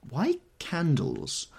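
slow, high, frowning objection; objecting. A narrator's reading. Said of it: "objected Daisy, frowning".

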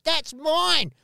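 high, loud mock squawk claiming the pizza; mock neighbour. Neighbour yelling over the pizza, high and loud. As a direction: grab it back like a greedy neighbour. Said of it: "came out and was like".

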